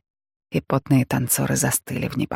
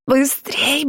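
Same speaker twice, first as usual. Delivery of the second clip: urgent shout; 11 semitones higher than usual. A narrator's reading. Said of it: "вдруг закричала Фрэнсис".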